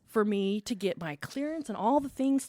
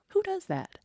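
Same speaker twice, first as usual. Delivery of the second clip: incredulous; 12 semitones higher than usual. Retold or imitated. Incredulous retold self-talk.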